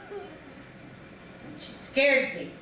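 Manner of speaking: ordinary delivery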